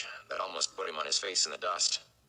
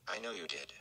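much softer, gentle and comforting; gently; 7 dB quieter than usual. A narrator's reading. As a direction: soothe him softly. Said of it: "she said gently".

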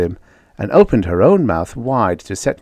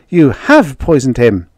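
raised, louder cry of dismay; anguished accusation; 5 semitones higher, 6 dB louder than usual. A narrator's reading. Jane blames Judy for poisoning the alien, anguished. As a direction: accuse in high-pitched distress. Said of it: "Jane cried in anguish".

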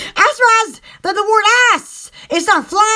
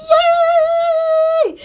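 high, drawn-out squeal of delight; long cheer; 7 semitones higher than usual. Acted. Vlogger cheering for the camera, high long squeal. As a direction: hold a high celebratory cheer.

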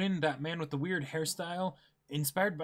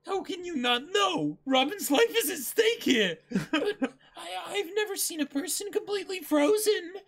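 high, breaking panicked voice with a laugh in it; half-laughing, half-crying alarm, much higher; panicked crew; 11 semitones higher than usual. Acted. Panicked argument read in character.

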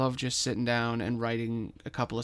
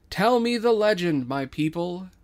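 loud, higher lordly command; regal king; 6 semitones higher, 6 dB louder than usual. Acted. King asking his people for the legend, regal. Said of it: "King gestures out to the audience".

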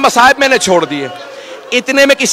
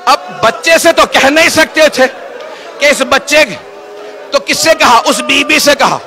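higher preaching, calling out the names; emotional recitation; 5 semitones higher than usual. Acted. Preacher telling the mourners of the infant, raised and calling. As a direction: lift the voice toward the grief.

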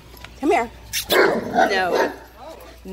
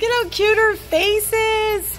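high, slow adoring coo; cooing at a cat; 12 semitones higher, slower than usual. Real, not acted. Woman cooing over a shelter cat's cute face, high and slow. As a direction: melt over the kitty's face.

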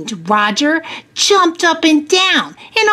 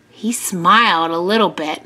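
plain reading voice

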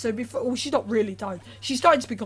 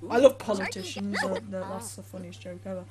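ordinary delivery

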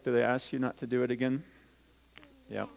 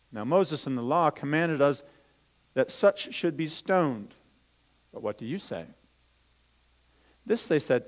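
put-on louder, lively storytelling voice for children; Bible story reading; 3 semitones higher, 5 dB louder than usual. Teacher reading the Bible story to children, lively storytelling. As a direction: read it out as a story to the kids.

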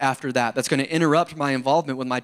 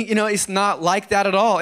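ordinary delivery

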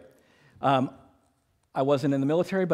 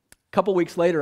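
ordinary talk, nothing marked

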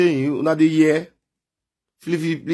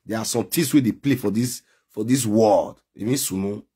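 ordinary talk

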